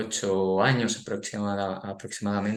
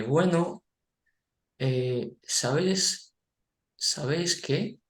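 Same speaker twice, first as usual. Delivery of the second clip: put-on higher, halting child's voice; a hesitant little boy, higher and halting; 6 semitones higher, much slower than usual. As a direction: stammer it like a shy boy about to confide. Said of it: "Con esa voz titubeante".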